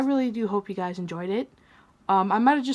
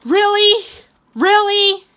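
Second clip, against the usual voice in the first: high, much louder, exasperated yell; outraged shout; 10 semitones higher, 10 dB louder, slower than usual. Real, not acted. Shot by his own teammate, he yells high and loud. Furious at being shot again.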